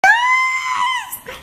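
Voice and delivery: very high squeal of yes; high-pitched triumphant shriek; triumphant yelp. Real, not acted. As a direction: cheer it out. Triumphant exclaim.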